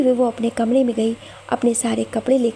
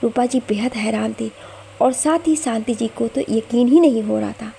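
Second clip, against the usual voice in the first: usual speaking voice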